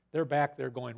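usual speaking voice

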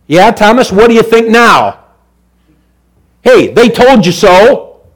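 loud, high ringing assurance; full-force preaching. Acted. Preacher encouraging the congregation, full-force preaching. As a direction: lift it up and proclaim it. Preacher retelling Jesus and Thomas.

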